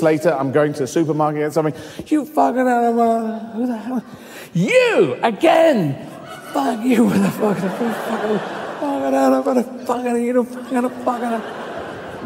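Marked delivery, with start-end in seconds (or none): 2.1-4.0 high, garbled outburst; hammed-up sweary abuse; mock abusive heckler. Mimics abuser met again at supermarket. 4.5-6.0 long, high drawn-out cry; hammed-up indignant yell, very slow; outraged recognition. Acts outraged recognition of the abuser. 6.5-12.3 high, garbled swearing on repeat; hammed-up tirade, pitched up; mock slanging match. Acts repeated gibberish slanging match.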